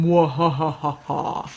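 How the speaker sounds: slow, spelled-out villain laugh; mock evil mwahahaha. Teasing secret project.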